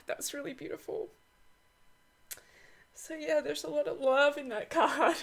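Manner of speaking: high voice, real choked-up feeling; emotional. Real, not acted. Creator remembering her dad over the Pisces card, emotional. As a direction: close to tears, laughing through it. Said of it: "I'm getting emotional talking about this".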